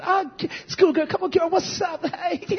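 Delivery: mocking a smooth talker. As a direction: imitate a boy sweet-talking a schoolgirl. Mimicking a boy chatting up girls.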